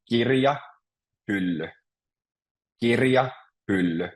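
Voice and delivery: kirja, hylly as two separate words, each stressed at the start; teacher's careful model of kirja, hylly, front-stressed; first-syllable stress. Shown as a demonstration. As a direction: stress KIR in kirja and HYL in hylly, pause between. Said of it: "always stress the beginning of each word".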